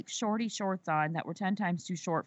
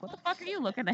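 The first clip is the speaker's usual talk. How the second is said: hostile, pitched much higher; devilish snap; 10 semitones higher, faster than usual. Retold or imitated. Tattooed woman glares back at judgy churchgoers, pitched-up snap. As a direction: bite it out at the starers like a devil. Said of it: "come off like the fucking devil".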